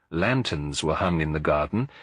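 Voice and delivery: ordinary delivery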